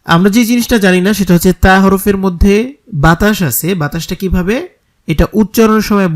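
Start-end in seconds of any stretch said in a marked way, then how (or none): none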